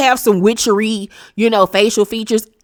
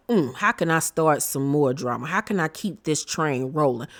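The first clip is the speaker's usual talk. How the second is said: scheming inner voice; 4 semitones lower, 7 dB quieter than usual. Retold or imitated. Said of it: "sitting there plotting".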